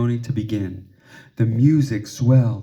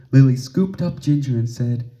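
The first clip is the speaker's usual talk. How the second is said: ordinary delivery, nothing marked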